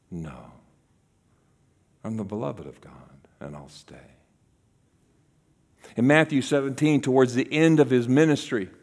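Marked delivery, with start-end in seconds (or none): 0.1-3.9 very low, hushed resolve; quiet self-reminder. Acted. Jesus answering from the cross, very quiet and low. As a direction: sink to a quiet, steady refusal. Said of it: "he would have to remember".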